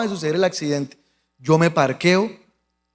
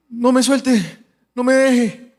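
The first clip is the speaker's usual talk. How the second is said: higher, strained imitation of the injured man; injured man's plea; 5 semitones higher, much slower than usual. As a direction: beg weakly in few words. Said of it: "Pero con muy pocas palabras". (súplica imitada de herido)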